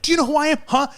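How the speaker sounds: mock spoiled driver demanding respect; indignant entitled girl. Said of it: "she was like".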